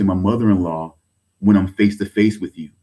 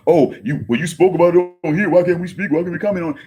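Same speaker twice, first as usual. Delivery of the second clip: mocking whiny critic; 6 semitones higher, faster than usual. Mocking critics' imagined complaint.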